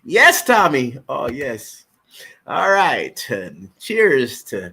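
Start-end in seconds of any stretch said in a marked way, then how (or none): none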